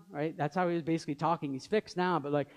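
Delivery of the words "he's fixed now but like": ordinary talk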